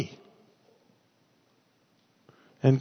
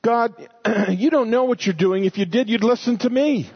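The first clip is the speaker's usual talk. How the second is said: higher-pitched imitation of a complainer; mock complaining; 6 semitones higher than usual. Preacher voicing a complainer talking back to God, mock complaint. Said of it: "Complaining and whining says".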